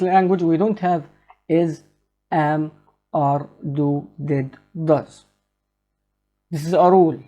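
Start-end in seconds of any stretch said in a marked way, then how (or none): none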